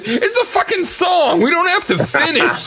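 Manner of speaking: high-pitched comic shout; exasperated retort. Retold or imitated. Singer tells injured partner to stop, exasperated shout. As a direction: holler it in disbelief.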